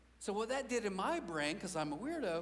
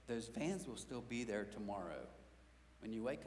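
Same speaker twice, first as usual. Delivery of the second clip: lower, softer, calm reassurance; gentle daughter; 7 semitones lower, 5 dB quieter than usual. Retold or imitated. Daughter to her dad, softly reassuring. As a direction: soothe him toward bed. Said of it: "She said".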